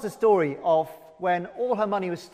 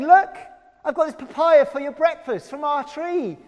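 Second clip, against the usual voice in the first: put-on much higher and louder child's voice; eager little neighbour boy; 8 semitones higher, 4 dB louder than usual. Little boy bringing papaya to the door, eager and high. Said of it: "a little boy from next door saying".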